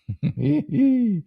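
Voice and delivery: high-pitched little giggle. Real, not acted. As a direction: let out a high, drawn-out chuckle. Own laugh after joking.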